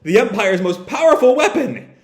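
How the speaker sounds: acted villain's shock; shocked disbelief. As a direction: react aghast to a crushing loss. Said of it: "the Empire is just shocked".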